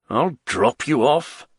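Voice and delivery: slow, grudging grumble; grumbled, drawn-out consent; grumbling. A narrator's reading. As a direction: agree with a grumble. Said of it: "grumbled Don Condor".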